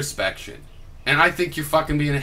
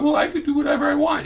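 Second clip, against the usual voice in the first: much higher and fast, a sing-song whine; whiny mock voice; 14 semitones higher, faster than usual. Mocking his opponent's whining in a high, fast whiny voice. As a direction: mimic a petulant kid whining. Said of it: "Stop whining about".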